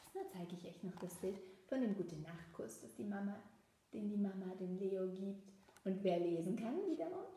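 ordinary delivery, nothing marked

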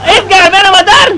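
panicked call for help. Real, not acted. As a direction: yell to a teammate for rescue. Pide ayuda en el juego. (grito de auxilio apurado)

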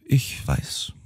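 soft, high whisper through tears; a sobbing sister barely whispering. A narrator's reading. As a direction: leise geflüstert. Tearful sister agrees.

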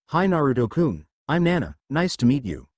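usual speaking voice

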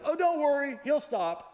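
high, loud, carefree owner impression; breezy dog owner. Preacher mimicking the pit bull owner to the congregation, breezy and high. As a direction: toss it off like an owner who never worries. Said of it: "this guy who always says this to you, goes".

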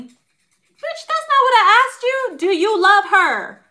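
high, loud, exasperated mom; mock yelling mother. Mother demanding her son answer, high loud yell. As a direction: shriek at him like his fed-up mother, slow on the last question.

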